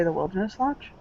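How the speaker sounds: a soft, unsure question; half-remembered name, asked gently; puzzled, quiet question. Acted. Father asking his daughter, soft and unsure. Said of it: "furrow his brow and say".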